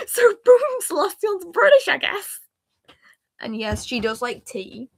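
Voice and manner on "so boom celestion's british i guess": slipping into British. Real, not acted. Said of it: "why am I sounding British?".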